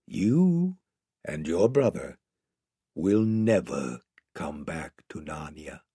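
low, hushed, tender lion voice; very gentle. Acted. Lion breaking sad news to two children, very gently. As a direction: let each phrase fall softly and low. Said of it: "said Aslan very gently".

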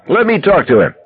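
high, bawled demand; Fenner bawling. A narrator's reading. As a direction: call it out high, taking charge. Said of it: "Fenner bawled".

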